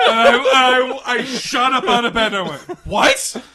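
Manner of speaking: high, loud, fast words through laughter; laughing. Real, not acted. Teen shocked awake by mom's news, laughing retelling. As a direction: tell it while still laughing hard.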